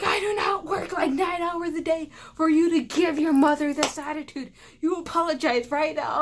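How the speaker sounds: loud, high-strained angry rant; mock-furious parental shouting, demanding an apology; yelling dad. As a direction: imitate an outraged dad shouting at his kid. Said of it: "yells at me".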